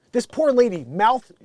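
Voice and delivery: ordinary talk, nothing marked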